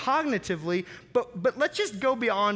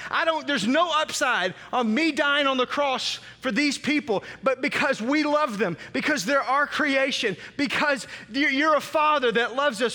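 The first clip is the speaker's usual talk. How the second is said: pleading cry; 6 semitones higher, 4 dB louder than usual. Retold or imitated. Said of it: "he cries out to God".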